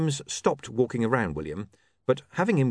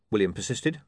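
ordinary delivery, nothing marked

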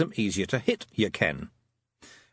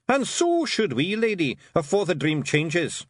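raised gruff little Scots pixie, pleased with himself; Rob Anybody's Scots voice; 6 semitones higher, slower than usual. A narrator's reading. Rob Anybody boasting to Tiffany after the fight, Scots pixie voice. Rob's voice.

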